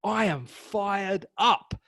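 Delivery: very slow, rising, pumped fired up; slow rising hype. Shown as a demonstration. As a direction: build the fire into it. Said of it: "which one do you believe".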